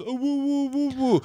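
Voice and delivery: high, soft mock wrestler whoops; high mock whooping; A J Styles impression. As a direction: hoot like a showboating wrestler. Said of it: "here's my impression of A J Styles".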